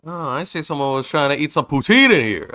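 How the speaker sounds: character voice. Acted. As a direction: announce the find like a proud detective. Voicing game character's discovery.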